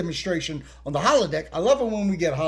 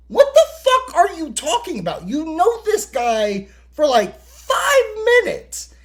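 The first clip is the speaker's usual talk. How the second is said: incredulous rant; 9 semitones higher, 4 dB louder than usual. Retold or imitated. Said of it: "I'm like".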